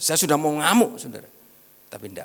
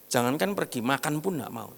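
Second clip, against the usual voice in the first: ordinary delivery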